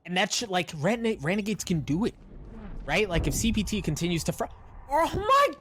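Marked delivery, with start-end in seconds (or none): 4.9-5.5 high, loud shriek of disbelief; excited scream. Real, not acted. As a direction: yell it in total shock. Caster erupts at another kill.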